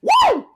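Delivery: very high, loud "woo"; halfling's whoop. Acted. Halfling winning gold at the table, high whoop. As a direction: let out one piercing celebratory whoop. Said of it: "the halfling just goes".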